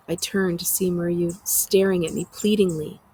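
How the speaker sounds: plain reading voice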